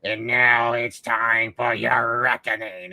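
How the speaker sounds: slow, menacing villain voice; Green Goblin. Retold or imitated. Goblin threatening Aunt May in hospital, slow and menacing. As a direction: stretch it out like a gloating villain. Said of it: "when Green Goblin's like".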